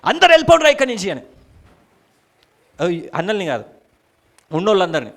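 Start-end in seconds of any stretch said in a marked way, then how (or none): 0.0-1.1 fast, high-pitched shouted order; loud shout. Acted. Joseph driving everyone out of the room, shouting at full voice. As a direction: yell at everyone to clear out. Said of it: "గట్టిగా అరిచాడట".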